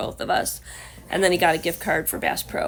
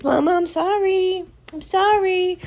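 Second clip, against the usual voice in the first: high, slow, louder voice for a sorry dog; apologetic dog; 12 semitones higher, 5 dB louder, slower than usual. Acted. Owner voices dog sorry for eating butter, high apologetic whine. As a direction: plead like a guilty pup. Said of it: "You were just trying to be like".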